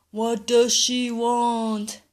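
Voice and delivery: slow, loud fish voice asking; the fish, slow and patient; magic fish voice. Acted. Fish asks grandpa.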